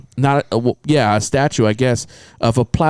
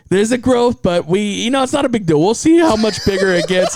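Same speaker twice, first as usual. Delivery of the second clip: higher-pitched imitation of a casual caller; clinic girl; 7 semitones higher than usual. Clinic girl calling Charles with results, high breezy imitation. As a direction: pitch it up, playing it down.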